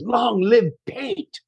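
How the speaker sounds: high, drawn-out mock Libyan accent; hammed-up accented rallying cry; put-on Gaddafi accent. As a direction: proclaim it slowly as a prank dictator's slogan, accent and all. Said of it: "I'm doing the whole accent".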